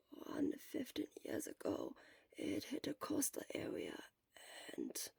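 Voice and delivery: weak whisper; barely a breath, worn out; whispered from a sickbed. Acted. Sick Shura whispers throughout.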